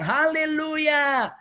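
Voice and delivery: high, slow drawn-out Haleluya; mother praising over a year of food; joyful hallelujah. Retold or imitated. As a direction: stretch it out in delight. Mother rejoices at the door.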